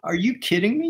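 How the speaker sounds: high-pitched, indignant disbelief, half-laughing; mock-outraged who-do-you-think-you-are; incredulous ranger. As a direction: scoff in disbelief as you imitate him, voice shooting up. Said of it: "He looks at me like".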